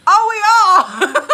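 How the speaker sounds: very loud, high, drawn-out exclamation; shocked realization. Retold or imitated. Imitating oblivious friend realizing the chase, shocked. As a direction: burst out as it suddenly dawns on you.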